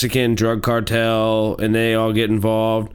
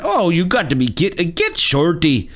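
higher-pitched imitation Irish accent; 6 semitones higher than usual. Podcaster imitating the main character of Get Shorty, mock Irish accent. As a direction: put on an Irish lilt, pitched up. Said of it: "he's got the Irish accent".

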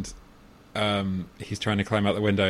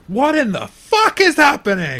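high, loud mock scream; imitated scream; 8 dB louder than usual. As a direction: scream it out like the film line. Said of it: "someone just screams".

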